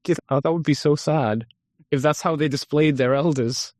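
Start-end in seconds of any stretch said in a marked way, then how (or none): none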